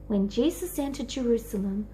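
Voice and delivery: ordinary delivery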